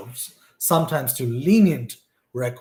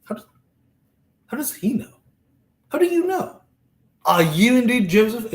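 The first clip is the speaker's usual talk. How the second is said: astonished; 9 semitones higher, 4 dB louder than usual. Retold or imitated. Brothers stunned before the ruler, high astonished voice. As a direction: voice shooting up in disbelief. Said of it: "And they're like".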